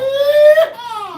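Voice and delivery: high, loud laughing yeehaw; cowboy holler. Pretend. Cohost echoes a viewer's yeehaw, laughing holler. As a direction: whoop it like a rodeo cowboy.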